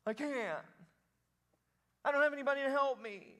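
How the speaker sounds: high-pitched helpless complaint; put-on whine, pleading helplessness; whiner. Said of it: "I think he's a whiner".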